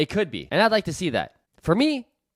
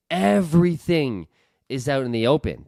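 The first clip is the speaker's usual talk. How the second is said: ordinary delivery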